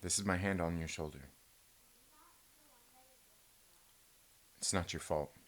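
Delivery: low, soft Good Will Hunting style reassurance; low hushed mock-tender comfort; mock therapist comfort.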